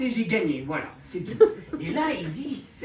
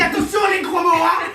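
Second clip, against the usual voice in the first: high, loud telling-off; mock scolding; 7 semitones higher, 9 dB louder than usual. Comedian's character scolds another for swearing, high and loud. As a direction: call out a hammed-up warning, pitched high.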